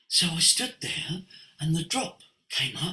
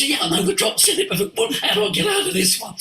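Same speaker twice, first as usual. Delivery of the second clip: mock panic; 9 semitones higher, 4 dB louder, faster than usual. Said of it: "He goes".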